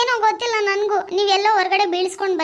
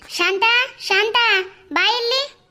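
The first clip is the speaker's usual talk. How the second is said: higher, calling her across the house; 4 semitones higher, slower than usual. Acted. Husband summoning his wife, calling out. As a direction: call Shanta in urgently.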